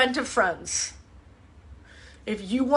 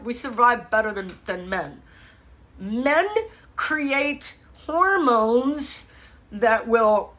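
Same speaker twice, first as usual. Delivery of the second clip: ordinary talk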